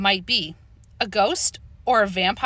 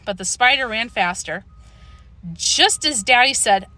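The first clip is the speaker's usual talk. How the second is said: usual speaking voice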